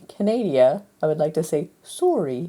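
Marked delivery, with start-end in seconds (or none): 1.8-2.5 soft mock-Canadian accent; put-on Canadian accent. Apologising for the Canadians, mock Canadian accent. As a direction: say "sorry" with a Canadian vowel. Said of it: "as the proud people of the Ca".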